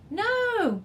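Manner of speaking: high, loud screech; alarmed screech at the poisonous frog. A narrator's reading. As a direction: shriek a warning. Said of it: "Maury screeched".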